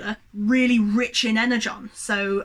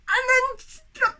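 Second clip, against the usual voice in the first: high, strained and overwrought; too worked up to carry on; 12 semitones higher than usual. Real, not acted. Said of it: "I'm getting really worked up".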